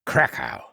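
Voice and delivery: low punchy krakow; a low crash for the blow; impact crash. A narrator's reading. Narrator voices the dragon's kick landing.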